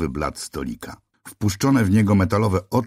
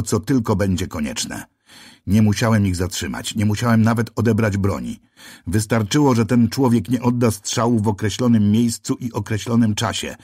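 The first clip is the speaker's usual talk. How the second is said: usual speaking voice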